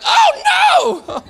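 very high, loud comic "oh no"; dismayed cry. Retold or imitated. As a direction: yelp it in sudden dismay. Arriving and realising the mistake.

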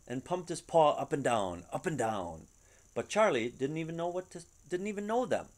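ordinary delivery, nothing marked

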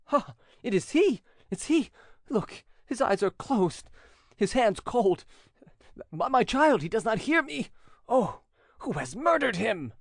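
high, loud, anguished cries over the body; a friend wailing in despair over the body; violent despair. Acted. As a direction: break down in grief over a dead friend. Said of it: "with every mark of the most violent despair".

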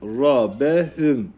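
Rabehüm drawn out slowly, vowels held; teacher modelling for students; slow recitation. Shown as a demonstration. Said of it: "Okuyalım".

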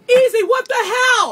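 loud, slow, shaken call; startled shout. Real, not acted. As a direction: yell out in shock. Shaken host calls to colleague.

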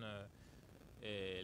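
usual speaking voice

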